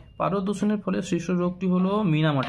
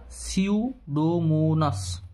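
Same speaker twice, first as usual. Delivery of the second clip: very slow repeat; much slower than usual. Shown as a demonstration. Teacher repeats answer slowly.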